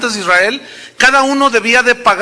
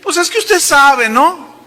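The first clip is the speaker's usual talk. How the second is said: much higher, sheepish hesitant voice; young woman's hesitant excuse; 7 semitones higher, slower than usual. Retold or imitated. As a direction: squeak it out like a woman caught and embarrassed.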